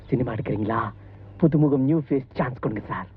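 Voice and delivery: hammed-up flattering request; mock sly pleading. Fake director tone shift.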